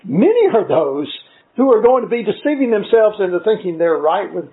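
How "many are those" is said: Many hit hard at the start; stress on many. Shown as a demonstration. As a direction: punch the word Many. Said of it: "I want to emphasize the word many".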